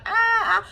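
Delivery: small, high imitated cry; high-pitched pretend wail, kept short; mock baby crying. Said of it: "like, crying".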